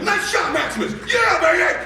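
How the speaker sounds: high, full-out celebratory shout; excited yelling. Retold or imitated. Dad cheering son on a golf course, yelling wildly. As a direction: whoop it out for your kid. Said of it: "I'm yelling".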